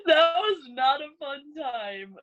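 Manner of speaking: laughing through it. Real, not acted. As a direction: laugh it off while admitting it was bad. Laughing about her heart disease.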